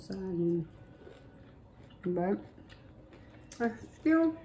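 food at the lips; taking a bite while talking. Real, not acted. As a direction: mouth starting to fill. Seen 4: Woman in orange talks holding food, brings it to mouth ~1.8s.

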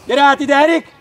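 loud, high shout to a player; calling over. Real, not acted. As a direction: summon him from across the pitch.

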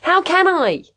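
high-pitched angry outburst; raging. A narrator's reading. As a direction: throw it back in exasperated rage. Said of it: "Caswell raged".

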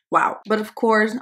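ordinary delivery

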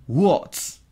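What's? clipped to one syllable wots; contracted what's. Shown as a demonstration. Teacher repeating the contraction, What's as one syllable. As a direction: say What's as a single beat. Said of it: "en lugar de what is, digo what's".

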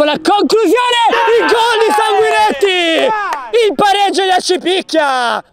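high excited goal call; goal shout. Real, not acted. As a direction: shout the equaliser. Equaliser called live.

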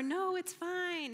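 high, drawn-out brush-off; imitated airy dismissal; mock reassurance. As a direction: wave it away in a singsong tone.

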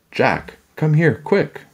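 put-on high, urgent girl's call. A narrator's reading. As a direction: call him over urgently. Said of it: "Annie called".

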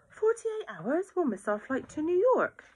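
aghast at the wait; a mother horrified to hear forty-eight hours, shocked; shocked disbelief. Acted.

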